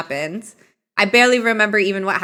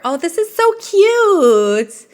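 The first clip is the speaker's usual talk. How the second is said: cooing; 9 semitones higher, 6 dB louder, slower than usual. Real, not acted. Gushing at cute game option.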